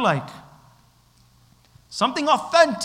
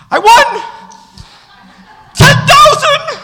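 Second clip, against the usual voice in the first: full-voice high, drawn-out victory yell; excited winner's scream; 12 dB louder than usual. Retold or imitated. Young man screams to his buddies over a lottery win, wild excited scream. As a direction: scream it like you just hit the jackpot. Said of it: "ran around his house screaming".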